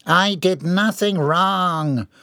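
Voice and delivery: high, drawn-out whiny protest. A narrator's reading. Guest caught by the collar protesting, whiny. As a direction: whine your innocence slowly. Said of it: "John hated how his voice sounded whiny".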